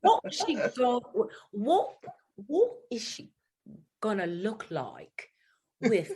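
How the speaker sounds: halting, drawn-out go at a Cockney accent; self-conscious fake Cockney with false starts; attempted Cockney accent. Shown as a demonstration. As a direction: try Cockney word by word, slowly, unsure of it. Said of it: "in your best accent".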